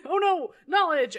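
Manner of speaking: laughing mock dismay. Ninja hit by the book, laughing mock horror. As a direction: fake panic while laughing. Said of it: "and then".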